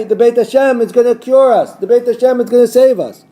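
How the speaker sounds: mimicking the people who trust the Temple to save them; mocking the complacent crowd. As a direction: parrot their smug certainty back at them. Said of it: "You say".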